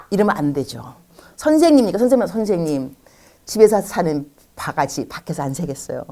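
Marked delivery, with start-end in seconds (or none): none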